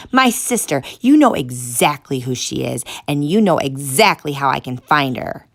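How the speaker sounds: loud accusing voice; furious. Acted. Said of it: "He became more furious".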